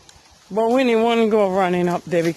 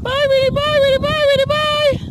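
high, louder sing-song bye; sing-song goodbye; 4 dB louder than usual. Pretend. Owner pretending to leave so her dog follows, high sing-song.

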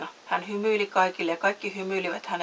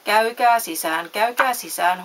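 louder doorman's call to the crowd; 2 semitones higher, 7 dB louder than usual. A narrator's reading. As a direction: call people in from the booth. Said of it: "huusi lipunkeräyskopperossa seisova mies". (kovaa kuuluttaen)

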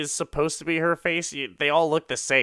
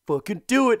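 high, loud furious order; hammed-up threat; angry demand; 10 semitones higher, 6 dB louder, faster than usual. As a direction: force him at gunpoint. She pulls a gun on him.

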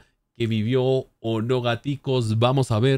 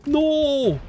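anguished shout; slower than usual. Real, not acted. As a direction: yell in dismay. Horror at friend defeated.

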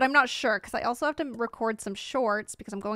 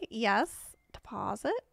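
ordinary talk

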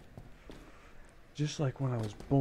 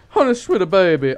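loud, higher yell of pet names; mock sweet shout; 5 semitones higher, 14 dB louder than usual. Real, not acted. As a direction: holler the pet names teasingly. Shouting at enemy mid-game.

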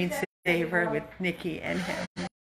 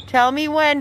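high, loud call across the yard; 7 semitones higher, 5 dB louder than usual. Real, not acted. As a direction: call out to someone who is checking. Calling to partner across the yard.

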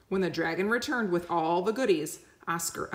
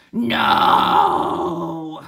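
loud dragon yell; 10 dB louder than usual. Acted. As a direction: snap a loud refusal. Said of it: "he yelled".

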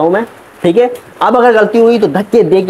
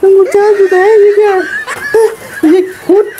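whiny begging; 11 semitones higher than usual. Acted. Actor begging the director not to stop the film, in a high whine. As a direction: wail for one more chance like a desperate child.

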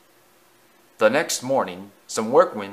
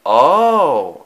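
loud, drawn-out "oh"; interrupting; 4 semitones higher, 10 dB louder than usual. A narrator's reading. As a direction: cut in with a big oh. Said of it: "Edward interrupted".

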